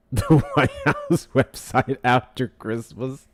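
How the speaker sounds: words dragged out through laughter; incredulous laughter. Real, not acted. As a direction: laugh in disbelief as you say it. Laughs at the outdated website.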